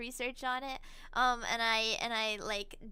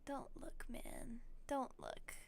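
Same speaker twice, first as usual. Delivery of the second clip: whispered; 4 semitones lower, 13 dB quieter than usual. Real, not acted. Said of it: "I hate having to do this".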